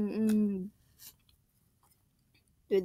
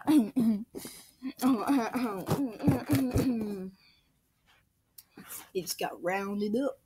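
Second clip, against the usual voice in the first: normal talking voice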